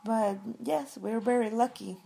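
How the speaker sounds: ordinary delivery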